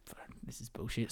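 half-whispered under the breath; muttered swear. Retold or imitated. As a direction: grumble it almost voiceless. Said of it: "I was like".

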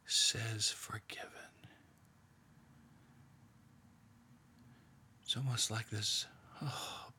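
hushed, half-whispered meditation, leaving long pauses; guided meditation. Acted. Leader guiding a prayerful meditation, in a hushed voice.

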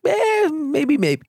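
sway undecided between yes and no; wavering maybe. Shown as a demonstration.

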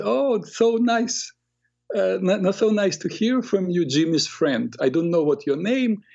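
man greeting a prank caller with exaggerated kindness; speaking nicely. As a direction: greet the boy kindly and gently. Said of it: "like, speaking nicely".

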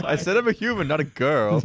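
put-on high, fed-up child's protest; exasperated kid. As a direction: whine it like a cornered boy. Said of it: "he resorts".

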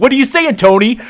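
high, loud looter voice, a touch hammed-up; mock looter. One looter asks his buddy Tony, in a high mock voice. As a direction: squeak it out as a nervous looter.

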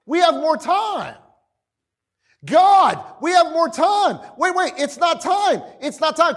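comic high-pitched dismay; panicked demons. Acted. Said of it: "they were like".

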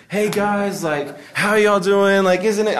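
high, drawn-out, over-animated emcee voice; church emcee. Retold or imitated. Church emcee greeting the crowd, hammed-up animated imitation. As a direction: gush like a fake-peppy church host. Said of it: "M Cs tend to talk different".

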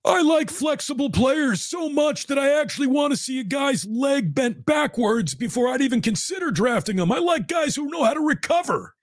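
high falsetto mock voice, way up; high mocking impression. Host mocking a coach in a high squeaky voice.

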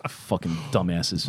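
muttered between sips of whiskey; words around a sip of drink; while sipping a drink. Real, not acted. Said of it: "I'm sorry, I'm drinking".

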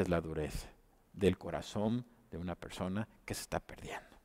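whispered, almost breathless warning; a hushed, solemn sermon aside; grave whisper. Acted. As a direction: drop to a whisper to chill them. Solemn point about lost soul.

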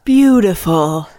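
slow, drawn-out mock guru; a parody of a cult sermon; mock profundity. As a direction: stretch it out as fake profound wisdom. Mocking the cult leader's love speech.

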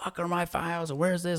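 a hammed-up pissed-off lawyer; pissed boss. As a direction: imitate a furious coworker hunting his files, half-hammed. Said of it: "he's pissed".

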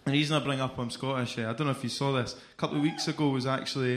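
ordinary talk, nothing marked

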